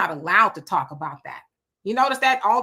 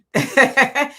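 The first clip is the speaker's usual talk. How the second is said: short, loud, higher laugh; amused laugh; 5 semitones higher, 6 dB louder than usual. Real, not acted. Host excuses herself for just reading, amused laugh. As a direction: laugh out a quick chuckle, loud.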